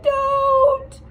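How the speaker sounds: high, loud, panicked cry; frightened cry. A narrator's reading. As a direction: shriek it in alarm. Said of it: "Poppy cried".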